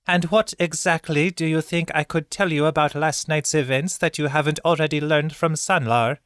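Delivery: high, terse and irritated; scowling birdkin woman. A narrator's reading. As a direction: challenge them, clipped and annoyed. Zael's voice, scowling.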